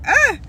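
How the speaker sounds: a sharp, high outcry to stop the tears; sudden high cry. Real, not acted. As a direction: yelp it out, high and loud. Stops herself from crying.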